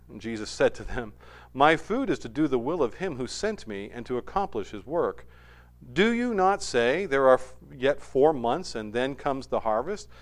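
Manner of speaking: scripture reading. Acted. Pastor reading scripture to the church, measured and low. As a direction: read the Gospel to the congregation. Reads scripture passage aloud.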